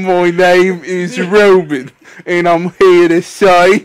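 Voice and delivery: slow rap in a fake English accent; bad English accent. Introducing himself as rapper, bad English accent. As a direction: chant it slowly with put-on English vowels. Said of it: "I do bad English accents".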